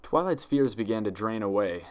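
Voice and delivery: usual speaking voice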